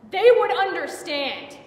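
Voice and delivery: loud, high, slow insistence; angry outburst. Acted. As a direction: burst out, driving each word home. Anger bursts out at them all.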